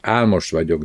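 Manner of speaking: plain reading voice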